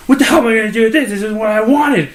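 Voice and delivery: fast, high, loud mock tirade; mock flipping out. Customer rejects the remade drink, mock flipping out. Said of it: "she starts flipping out".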